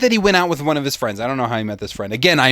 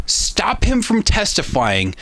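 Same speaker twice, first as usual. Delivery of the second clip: ordinary talk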